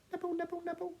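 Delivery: very high, tiny rapid squeak; nibbling mouse. Acted. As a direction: squeak the word as tiny busy bites. Mouse nibbling sound acted.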